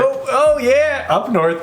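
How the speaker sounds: high squealing Minnesotan "oh yeah"; Minnesotan accent. Pretend. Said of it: "people literally talk like this".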